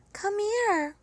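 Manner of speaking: a hint of a warm friend; tender call. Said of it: "she said".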